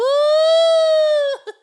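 squealing high laugh; real giggle. Real, not acted. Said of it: "laughs at her own howl".

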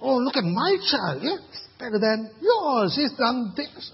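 smug mum showing off, high and mocking; boastful mother. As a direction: brag about your child, hammed up. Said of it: "You know, that, that's".

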